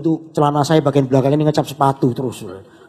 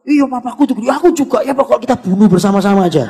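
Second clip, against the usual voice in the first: higher, mock-eager commiserating; eager agreement; 5 semitones higher, 3 dB louder than usual. Two bitter friends egg each other on about their fathers, mock eager. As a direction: chime in like a co-conspirator.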